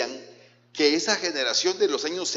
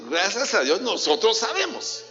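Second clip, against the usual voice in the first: higher, proud emphatic repeat; 6 semitones higher than usual. Shown as a demonstration. Preacher repeats for the congregation, higher and emphatic. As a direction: say it again with conviction. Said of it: "Voy a repetir".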